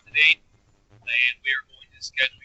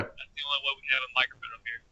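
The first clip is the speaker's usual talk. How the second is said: tin can sound; 7 dB quieter than usual. Real, not acted. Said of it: "You sound like you're in a tin can".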